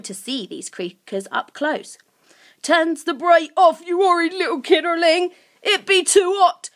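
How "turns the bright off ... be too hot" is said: high, loud creature outcry; cowering cry. A narrator's reading. Grunt cowers from Lucy's torchlight, crying out. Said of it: "Grunt cried, cowering away from the torchlight".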